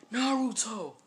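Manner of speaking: high, loud cry of his name; alarmed shout. Acted. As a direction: call out as he falls away from you. Inner figures cry out as he falls.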